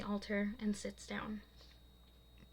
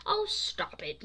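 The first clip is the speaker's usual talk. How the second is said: annoyed; 11 semitones higher, slower than usual. Acted. Annoyed protest.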